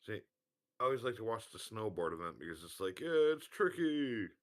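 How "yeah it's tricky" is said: game announcer voice. Retold or imitated. Quoting the SSX Tricky game announcer.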